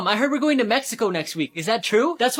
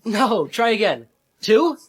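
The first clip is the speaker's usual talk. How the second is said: ordinary talk, nothing marked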